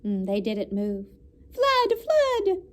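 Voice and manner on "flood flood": much higher alarm cry; shouting flood. A narrator's reading. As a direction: call it out in mock panic. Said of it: "he cried".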